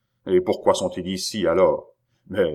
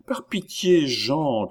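high, imploring cry; cried plea; 7 semitones higher, slower than usual. A narrator's reading. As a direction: beg him with voice pitched up. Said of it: "cria Chon, joignant les mains". (supplication aiguë)

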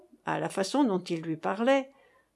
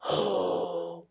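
long, low, sinking groan; stricken "oh"; 4 semitones lower, 4 dB quieter than usual. A narrator's reading. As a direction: let out a drawn-out, lower "oh". Stricken reaction to the revelation. (long gémissement accablé)

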